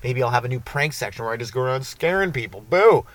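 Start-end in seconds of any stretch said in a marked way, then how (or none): 2.6-3.0 sudden high, loud boo; playful scare. Pretend. Prankster to his roommate, a sudden playful scare. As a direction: pop it out to startle someone.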